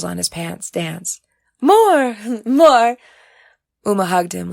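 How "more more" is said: much louder, higher, gleeful with laughter; laughing shout. Acted. Oriaku demanding more praise from Uma, laughing shout.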